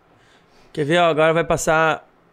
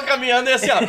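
laughing; 5 semitones higher than usual. Real, not acted. Rindo ao contar o vômito.

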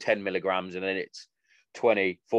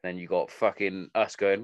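usual speaking voice

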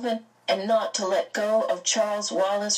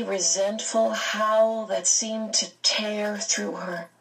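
usual speaking voice

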